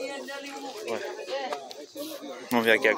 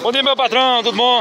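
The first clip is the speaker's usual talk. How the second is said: higher and louder, cheerful call; hearty greeting; 10 semitones higher, 7 dB louder than usual. Real, not acted. Vlogger calling out good morning to a cattle owner, loud. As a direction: hail him warmly from afar.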